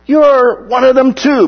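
high, drawn-out accusing call; acted accusation. Bystander spots Peter by the fire, high acted accusation.

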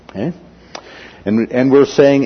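ordinary talk

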